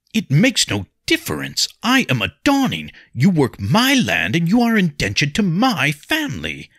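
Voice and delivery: loud, high-pitched commanding outburst; forceful. A narrator's reading. Nobleman cowing a tenant of his family, forceful. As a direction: assert your rank at full force. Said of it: "His forceful tone cowed her".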